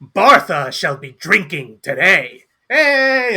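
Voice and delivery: loud, slow proclamation ending in high whoop; Bartha. Acted. Dwarf announcing herself to the tavern, loud and boastful. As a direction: declare it boldly like a dwarf champion. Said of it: "And she's like".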